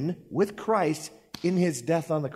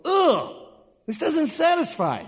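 much higher and louder ugh of a hammed-up eater; mock disgust; 12 semitones higher, 4 dB louder, slower than usual. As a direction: recoil from the cheese with a big groan. Mock disgust at Limburger cheese.

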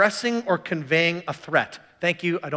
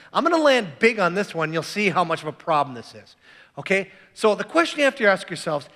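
ordinary talk

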